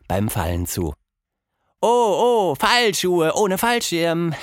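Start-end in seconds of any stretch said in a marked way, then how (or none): 1.8-4.4 put-on high, slow, goofy little girl voice; a kid cracking a joke to herself; high childlike voice, slowly savouring the pun; silly girl joke. Tilda jokes alone.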